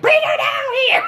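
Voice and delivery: high, loud, laughing mock call from mom; mother calling out. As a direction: holler it down the stairs like mom. Mother calls down the stairs.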